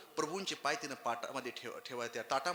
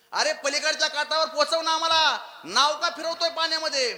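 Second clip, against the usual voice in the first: scolding; 10 semitones higher, 10 dB louder than usual. Acted. Ram scolds the boatman.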